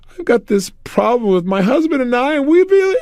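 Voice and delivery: high, voice breaking into tears; tearful woman. Retold or imitated. Tony imitating a crying woman at his seminar. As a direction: mimic a woman starting to cry.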